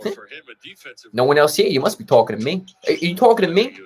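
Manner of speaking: tough New York challenge with rising questions; cocky New York movie impression; Robert De Niro. Shown as a demonstration. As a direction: play the famous movie confrontation. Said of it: "I can do the Robert De Niro".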